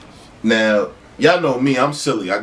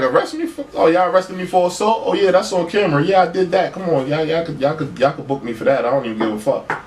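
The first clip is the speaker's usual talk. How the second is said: mock cocky shrug-off; 7 semitones higher than usual. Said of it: "So I'm like".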